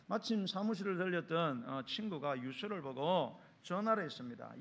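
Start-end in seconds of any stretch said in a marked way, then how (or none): none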